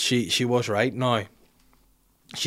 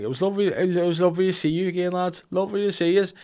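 high-pitched, lilting sing-song gush; soppy high-pitched drunken send-off; drunk gushing farewell; 8 semitones higher than usual. Retold or imitated. Drunk goodbye texts acted out.

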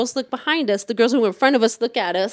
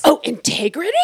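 mock disbelief; 5 semitones higher, 3 dB louder than usual. Mocking the other man's word.

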